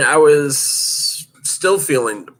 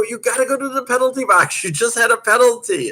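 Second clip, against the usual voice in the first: official's voice; 13 semitones higher than usual. Retold or imitated. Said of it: "They go".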